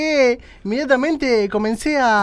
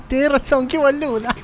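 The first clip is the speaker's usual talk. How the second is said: giggling at your own blunder; high giggle through the words; laughing; 5 semitones higher than usual. Acted. Laughs at own silly mistake.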